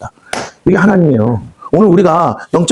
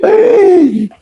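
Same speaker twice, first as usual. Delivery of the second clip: gloating devil's shout; much slower than usual. Acted. Devil gloating after striking.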